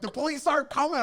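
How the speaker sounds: high, insistent rebuttal; exasperated correction. Retold or imitated. Storyteller correcting the panicked crowd, exasperated.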